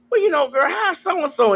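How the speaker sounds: high-pitched, chatty mock girl talk; prying girlfriend. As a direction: put on a casual, nosy friend asking after someone. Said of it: "somebody asks you".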